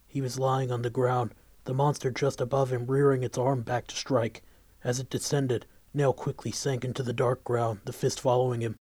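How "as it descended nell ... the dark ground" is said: usual speaking voice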